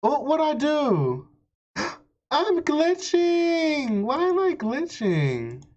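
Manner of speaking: loud, high, alarmed whine; panicked surprise. Real, not acted. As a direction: panic as the screen breaks. Screen glitching, panicking.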